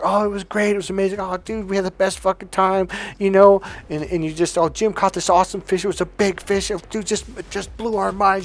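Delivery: excited guy. Retold or imitated. Husband to wife about fishing trip, gushing excitedly. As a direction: gush about the trip like a kid. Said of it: "And you're".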